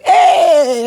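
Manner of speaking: loud, high hype shout; hype yell. Retold or imitated. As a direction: shout a big party greeting. Shirtless singer's loud greeting.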